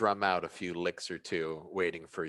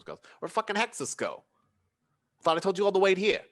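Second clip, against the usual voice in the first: annoyed Ratatat; 2 semitones higher, 4 dB louder than usual. Acted. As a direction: demand where Hexus went. Ratatat asks where Hexus is.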